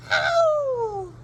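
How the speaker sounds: high yelp of pain; sharp cry of hurt; yelled. Acted. Father bitten on the nose, yelping ow. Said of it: "he yelled".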